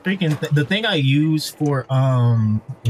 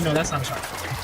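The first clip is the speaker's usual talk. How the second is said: normal talking voice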